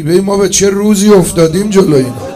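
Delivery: loud anguished wail on «روزی»; crying out lament. Real, not acted. Preacher laments to mourners, loud anguished cry. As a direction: cry it out to the crowd.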